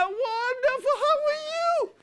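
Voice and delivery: high, gushing false welcome; fake sweet greeting. Parent greeting dinner guests they gossiped about, gushing and fake. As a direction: lay the fake friendliness on thick.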